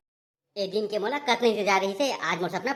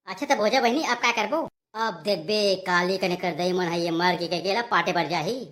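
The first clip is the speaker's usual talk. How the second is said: ordinary delivery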